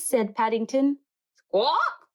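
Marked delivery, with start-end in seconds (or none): 1.3-2.1 a high squawking parrot cry. A narrator's reading. Parrot squawking at Paddington, high squawk. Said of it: "said a parrot".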